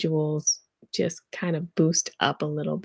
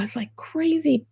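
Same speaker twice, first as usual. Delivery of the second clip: ordinary talk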